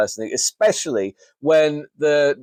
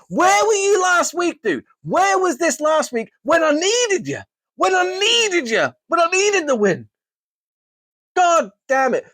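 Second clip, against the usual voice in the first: pitched way up, pleading where were you; anguished high wail; 14 semitones higher than usual. Real, not acted. Fantasy manager wailing at his team's late form, anguished high wail. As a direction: cry out betrayed by the team. Yelling at the Vikings for last week.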